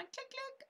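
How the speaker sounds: tiny high, soft clicking voice; mouse-click imitation. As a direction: squeak out two little clicks.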